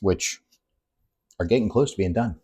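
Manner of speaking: usual speaking voice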